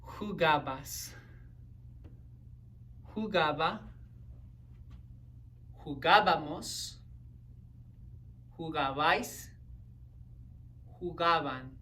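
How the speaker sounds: each form from Jugabas to Jugaban said alone, stressed on GA; pronunciation drill. Shown as a demonstration. Say 'em with me, careful drill of Jugabas to Jugaban. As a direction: model Jugabas through Jugaban carefully, pausing for repetition. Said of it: "let's practice the pronunciation".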